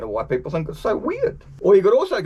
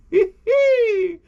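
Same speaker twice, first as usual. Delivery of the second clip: a quick high burst of laughter; 4 dB louder than usual. Real, not acted. Joking with viewers about Kiwi slang, real laugh. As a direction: laugh at how silly it sounds. Laughs after his joke.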